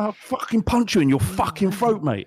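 hammed-up Cockney geezer; Cockney threat. Riffing on pitch Cockneys, mock Cockney threat. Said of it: "I go a bit Cockney".

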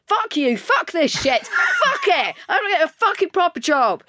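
high, loud retold rant breaking into laughter; shrieky mock outburst; panicked swearing outburst. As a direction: re-enact losing it, laughing through the curses. Re-enacts her panicked outburst at snapping branch.

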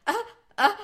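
high, loud, broken little cries; mock distressed gasps. Acting her reaction to the painting scene, mock distressed gasps. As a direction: imitate starting to break down.

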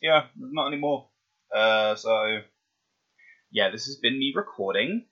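ordinary delivery

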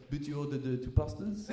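put-on quiet question in a French accent. Waiter querying a diner's double pasta order, French accent. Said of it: "he had a French accent".

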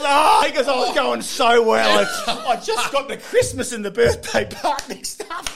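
high, loud, slow mock wail through laughter; wailing lament. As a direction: cry out in comic dismay, laughing. Friend's lament after botched greeting.

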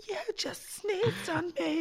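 high, slow, laughing mock outrage; shocked victim. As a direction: squeak it out through laughter. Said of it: "they'd be like".

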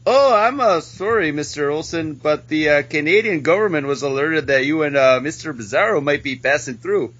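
put-on sorry-to-say Canadian accent, sliding into Mexican; Canadian accent turning Mexican. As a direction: play a hesitant Canadian border official whose accent wanders toward Mexican. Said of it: "Your Canadian got very Mexican".

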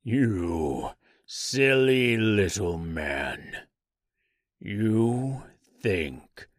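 slow, drawn-out monster voice; taunting tree creature. Acted. Giant tree to the Tin Woodman, slow monstrous taunt. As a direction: stretch each word as a huge creature.

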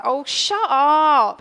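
slow, loud, drawn-out oh shut up; disbelieving. Retold or imitated. Sister slowly and loudly dismissing her brother's claim. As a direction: brush off the teasing, slowly. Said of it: "I was like".

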